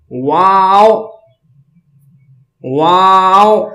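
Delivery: واو with both lips rounded, drawn out. Shown as a demonstration. Teacher demonstrating the letter واو, lips rounded. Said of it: "دونوں ہونٹوں کو گول کریں گے".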